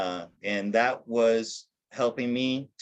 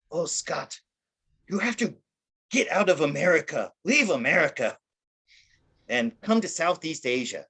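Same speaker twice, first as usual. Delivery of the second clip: light German accent, imitating a friend's urging; a hint of a German woman urging him abroad; 5 semitones higher than usual. Friend Anya urging him to leave America, in a mild German accent. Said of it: "in her German accent".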